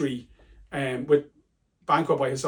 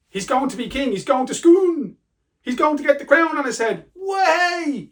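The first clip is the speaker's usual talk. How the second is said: much higher, gleeful crowing; mock-excited cheer; 11 semitones higher, 4 dB louder than usual. Teacher hyping Macbeth's coronation, mock-excited cheer. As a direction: whoop it up, building to a long high cheer.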